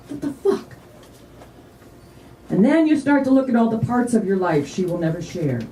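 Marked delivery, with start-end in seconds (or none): 0.1-0.8 high, hushed disbelief; bewildered inner voice. Acted. Mourner unable to grasp she's not here, bewildered.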